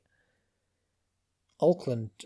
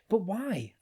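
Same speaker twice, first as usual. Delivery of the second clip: plaintive; 9 semitones higher than usual. A narrator's reading. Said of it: "asked Zenda plaintively".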